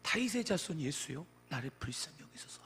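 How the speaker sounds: ordinary talk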